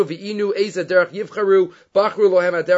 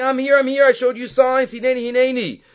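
high, insistent calling out; retold cry to be noticed, pitched high; mild mock scream; 7 semitones higher than usual. God calls to an unlistening nation, mildly screaming. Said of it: "I started screaming".

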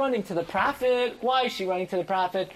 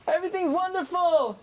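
excited; 10 semitones higher than usual. Real, not acted. Same words repeated.

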